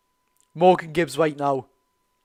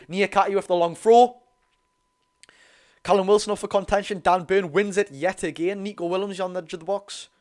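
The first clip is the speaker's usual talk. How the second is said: excited fan commentary, building; live play-by-play; 2 semitones higher than usual. Acted. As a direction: call the attack like a match commentator.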